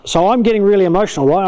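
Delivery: ordinary delivery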